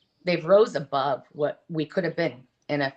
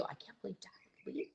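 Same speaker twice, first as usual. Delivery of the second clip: much softer, breathy, quick and hurt; hushed disbelief; 3 semitones higher, 20 dB quieter, faster than usual. Retold or imitated. Mother quoting her kids about their dad, hushed. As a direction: let it slip out like a child's stunned hush.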